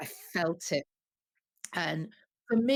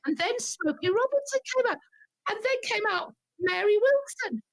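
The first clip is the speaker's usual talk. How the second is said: breathless-fan excitement, pitched very high; excited retelling; 13 semitones higher than usual. Retold or imitated. Fan telling how Motown stars came out, excited. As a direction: let the voice soar with each star's arrival.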